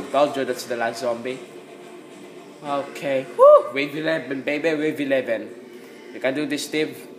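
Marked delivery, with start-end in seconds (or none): 3.4-3.7 high, loud victory whoop. Real, not acted. Gamer hitting wave eleven, loud whoop. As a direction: cheer like you just won.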